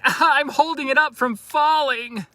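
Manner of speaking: high, loud mock exclamation; mimicking posing tourists. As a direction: imitate tourists pretending to prop up a tower. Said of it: "they put their hand up and they're like".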